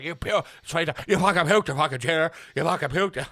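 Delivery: garbled, shouted mock accusation; loud jeering impression. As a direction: bellow it like a jeering crowd. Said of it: "everyone's like".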